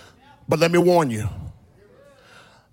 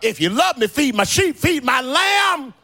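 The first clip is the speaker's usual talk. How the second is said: raised, ringing sermon delivery; full-force preaching; 9 semitones higher, 3 dB louder than usual. Acted. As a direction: preach it out like a climax, half shouted.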